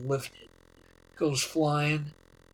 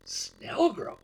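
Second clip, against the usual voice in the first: SNELLGROVE echoed high in mock disbelief; imitated incredulous repeat; retold startled echo; 7 semitones higher than usual. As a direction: repeat Snellgrove as a taken-aback pilot, pitched up. Pilot's startled echo of destination.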